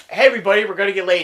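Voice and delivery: high, loud quoted rallying call; mock excited announcement. Retelling a movie quote to mom, mock excited announcement.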